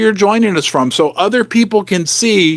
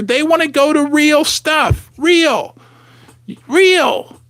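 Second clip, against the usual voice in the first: excited shout; 7 semitones higher, slower than usual. Real, not acted. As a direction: yell it with passion. Holding up silver, shouting real.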